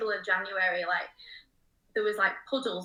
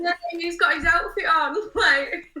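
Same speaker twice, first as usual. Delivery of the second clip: high, loud, laughing through the words; helpless giggly retelling; 8 semitones higher, 6 dB louder, slower than usual. Retold or imitated. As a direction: barely get it out for laughing. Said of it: "I was laughing my head off".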